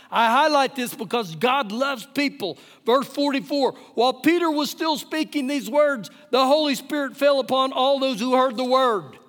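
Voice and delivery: emphatic preaching. Acted. Pastor proclaiming scripture to the congregation, emphatic preaching. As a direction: drive each phrase home to the congregation.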